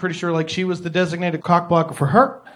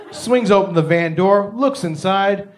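ordinary delivery

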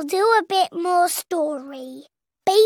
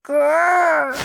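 dinosaur growl; 4 semitones lower than usual. Pretend. George's toy dinosaur growl.

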